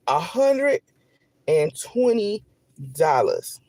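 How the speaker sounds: 한 단어씩 높게 끊어서. Real, not acted. Spelling out the amount.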